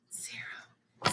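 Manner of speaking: breathy whispered number. Shown as a demonstration. Teacher whispering every other number in a counting drill, whispered. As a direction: whisper it, no voice at all. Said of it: "Now we're gonna whisper every number".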